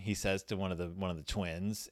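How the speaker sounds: normal talking voice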